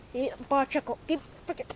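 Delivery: ordinary delivery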